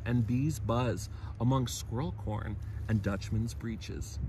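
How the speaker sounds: light, lilting nature-verse reading; poem recital. Acted. Narrator reciting a spring wildflower verse, lilting. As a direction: savor each rhymed image.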